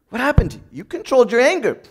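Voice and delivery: ordinary talk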